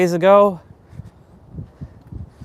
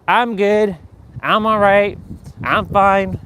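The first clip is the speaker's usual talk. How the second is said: higher-pitched, mock-chipper stock replies; automatic fake fine; 5 semitones higher, slower than usual. Mocking people who hide their feelings, higher pat answers. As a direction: rattle off hollow stock answers. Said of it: "Don't give me your".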